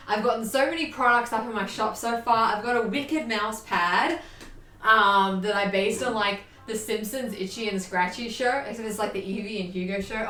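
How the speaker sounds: ordinary delivery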